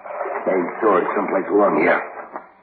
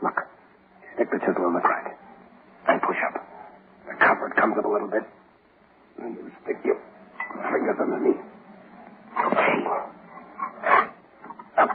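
whispering; 2 semitones higher, 6 dB quieter than usual. Acted. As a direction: whisper instructions under your breath. Whispered instructions during escape.

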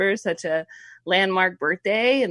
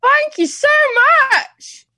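1 mock English accent; 6 dB louder than usual. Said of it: "sounds like [name]".

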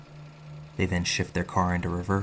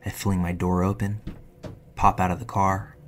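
ordinary delivery, nothing marked

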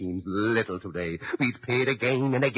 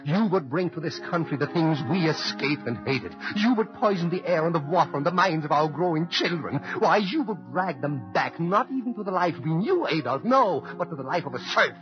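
rising defiance; 5 semitones higher than usual. Acted. Immigrant defying Hitler, building passionate outrage. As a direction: defy him more fiercely with each line.

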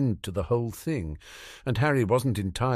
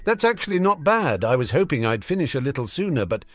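cheeky teasing voice; 6 semitones higher, 3 dB louder than usual. A narrator's reading. Harry teasing Draco.

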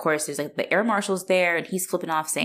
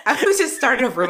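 high, loud, fast through laughter; laughing; 7 semitones higher, 5 dB louder, faster than usual. Real, not acted. Joking about starting a rumor, laughing. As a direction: talk while cracking up.

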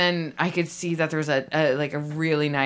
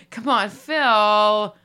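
slow, drawn-out, louder plea; exasperated plea; 3 semitones higher, 6 dB louder, much slower than usual. Acted. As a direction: drag it out, fed up with his crying. Exasperated plea to crying skipper.

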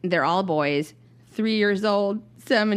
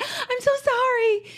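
much higher, slow, stretched apology; over-apologetic; slower than usual. Retold or imitated. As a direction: plead sorry in a tiny, drawn-out voice. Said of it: "and I was like".

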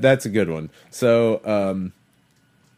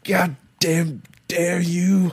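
angry slurred voice; 4 semitones higher, much slower than usual. Acted. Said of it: "I'm so mad I'm not even speaking right".

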